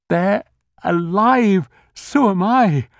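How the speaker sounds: high, loud jubilant cry; exultant. A narrator's reading. Jerry exulting that his family is alive, high and loud. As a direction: burst out with joy.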